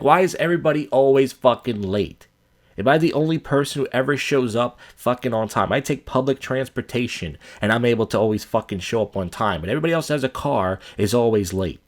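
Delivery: ordinary talk, nothing marked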